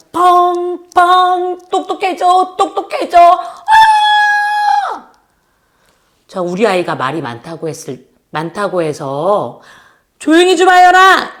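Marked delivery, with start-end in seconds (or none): 0.1-5.1 high, slow, stretched cry of joy rising to a shout; excited. Acted. Teacher cheering to children that brains get smart, high excited shout. 10.2-11.4 scolding parent. Retold or imitated. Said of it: "이렇게 하면 안 됩니다".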